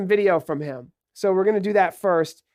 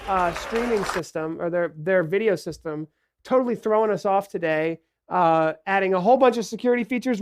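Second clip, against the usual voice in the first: normal talking voice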